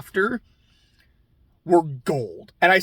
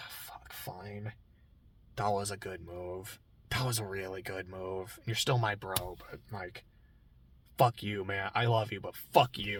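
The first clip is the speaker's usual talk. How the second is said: low, soft, grudging concession; grudging mutter; 10 semitones lower, 14 dB quieter than usual. Retold or imitated. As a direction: give in with a sigh. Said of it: "It's like".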